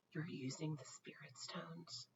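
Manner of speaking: hushed, unvoiced and slow; awed whisper. Jen whispering in awe at the spirit stones. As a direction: breathe it out in wonder. Sister suddenly awake, eyes wide.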